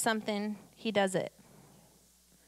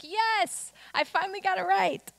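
higher-pitched mock celebration; triumphant Peter; 8 semitones higher than usual. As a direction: exult as a disciple finally getting it. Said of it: "He's finally like".

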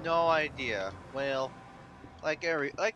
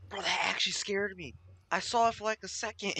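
higher, still rattled; real rattled excitement; shaken excitement; 8 semitones higher than usual. Real, not acted. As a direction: talk fast while still jumpy from the scare. Recovering from the scare.

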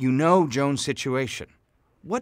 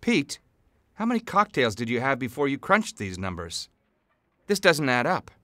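angry; 9 semitones lower, 8 dB louder than usual. Acted. Boss scolding employee over numbers.

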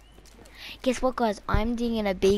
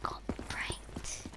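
whisper; 9 dB quieter, much slower than usual. Real, not acted. Prank reveal whisper.

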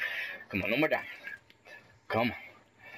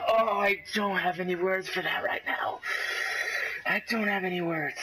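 speechless dismay; 4 semitones higher than usual. Real, not acted. Player speechless after another death, speechless dismay. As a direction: let it out in strained disbelief.

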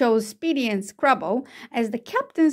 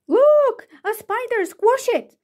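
high-pitched shriek, loudest at the start; alarmed scream; 11 semitones higher, 3 dB louder, slower than usual. A narrator's reading. Child spotting a spider in class, screaming in alarm. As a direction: squeal out in panic. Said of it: "screamed someone".

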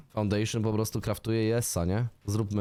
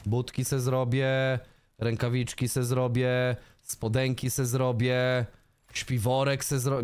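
drawn-out daydreaming list; slow listing; slower than usual. Real, not acted. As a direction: savour each item slowly. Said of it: "slow dreamy listing". (powolne, rozmarzone wyliczanie)

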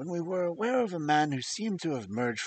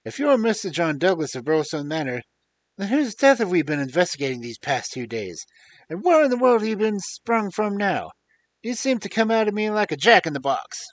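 louder and higher, exclaiming in disbelief; astonished outburst; 6 semitones higher, 10 dB louder than usual. A narrator's reading. Inspector questioning the man thought dead, in loud amazement. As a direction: inspector can't believe his eyes, half shouting.

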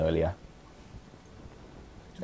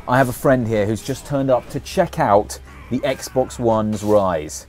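ordinary delivery

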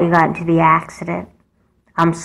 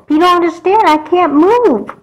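panicked plea; 12 semitones higher, 4 dB louder than usual. Acted. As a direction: beg in terror before the push. Paraplegic girl pushed into water.

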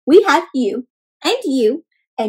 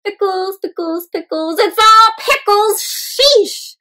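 high, loud fed-up sigh; exasperated egg; 8 semitones higher, 4 dB louder, slower than usual. Acted. Shell complains about the pickle aisle, high and loud. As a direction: throw up your hands at the pickles.

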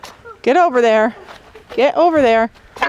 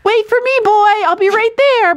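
put-on high, eager voice calling after a boyfriend; goat's voice; 11 semitones higher than usual. Voicing Toffee the goat calling to the boys, high and eager. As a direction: play a young goat rushing to her crush. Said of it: "She's like".

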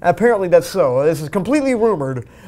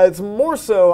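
ordinary delivery

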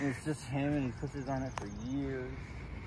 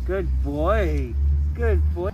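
dog praise; 3 semitones higher, 14 dB louder, much slower than usual. Real, not acted.

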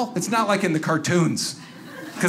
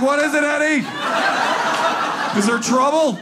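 mock alarmed pet owner; 6 semitones higher than usual. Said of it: "I'm like".

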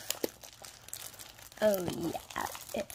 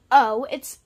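delighted; 12 dB louder than usual. Real, not acted. Sees new outfit.

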